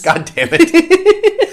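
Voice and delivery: drawn-out, high, loud groan of mock fury; mock outrage. Real, not acted. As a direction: wail it slowly like a hammed-up tantrum. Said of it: "I'm going to be very angry".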